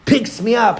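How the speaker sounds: ordinary delivery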